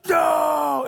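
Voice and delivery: high, loud shrieking no; imitated child's scream. Daughter screaming at dad not to touch it, shrieking.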